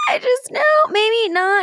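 very high, loud flustered squeal; mock panicked wail. Retells her panicked reply to child.

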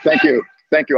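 ordinary delivery

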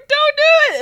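begging him not to start crying; pleading cry. Retold or imitated. Bride to her tearful dad, loud high plea.

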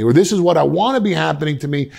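ordinary delivery

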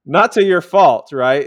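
loud slow not to your fault; emphatic correction. Real, not acted. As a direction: belt out the fixed word. Laughing correction of a slip.

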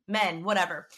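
usual speaking voice

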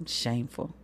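low and soft, disappointed; one soft low word of shame; quiet verdict. Real, not acted. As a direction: let it drop, shaking your head. Closing verdict.

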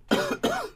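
sudden harsh choking cough. Real, not acted. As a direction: cough hard, choking on a drink. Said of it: "Sorry, choking to death here".

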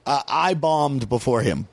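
ordinary delivery

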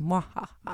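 soft evil cackle; mad scientist laugh. Pretend. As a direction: cackle quietly like a villain. Halloween lives on.

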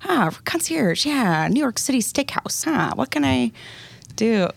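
high, slow, drawn-out tourist voice; clueless tourist voice. Retold or imitated. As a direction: play a lost tourist, slow and sing-song. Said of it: "they're like".

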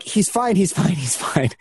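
imitated breezy reassurance, starting high; brushing off worry. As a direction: wave it off, repeating lightly. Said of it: "I'm like".

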